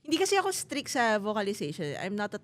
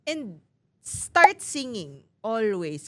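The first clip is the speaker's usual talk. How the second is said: slow and louder, each word leaned on; slow emphatic; 2 semitones higher, 7 dB louder, much slower than usual. Real, not acted. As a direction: drive it home slowly. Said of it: "urging practice". (dahan-dahan at diin)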